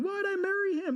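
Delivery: high mock wail; mock crying wife. Wife in the van crying over her husband, high mock wail. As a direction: whine it like a wife despairing in the van. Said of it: "My wife is in the van crying".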